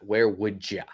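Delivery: "Where would ya?" with a soft schwa for you; reduced "ya". Shown as a demonstration. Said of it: "My pronunciation is that".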